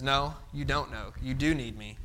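quiet divine rebuke. Retold or imitated. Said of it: "God just shakes us and says".

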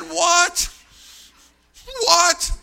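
high, explosive acted outrage; incredulous shout. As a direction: shout in utter disbelief. Acting his outraged disbelief.

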